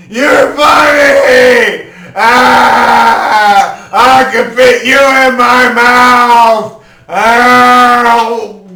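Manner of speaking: put-on a giant toddler squealing with glee; gleeful giant. Giant holds Pykel to face.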